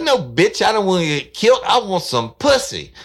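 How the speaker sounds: loud, brash retort; crude caller. Retold or imitated. Prank caller shouting back at the woman, loud and crude. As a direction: bark it back at her.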